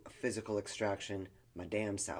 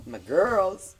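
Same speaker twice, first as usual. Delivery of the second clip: affectionate exclaim; 8 semitones higher, 11 dB louder than usual. Real, not acted.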